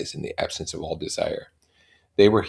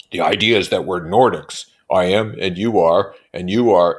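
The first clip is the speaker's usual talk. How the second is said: louder pompous man; loudly voiced Tom; Tom's voice; 8 dB louder than usual. A narrator's reading. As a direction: lecture like a brute. Tom voiced in read novel.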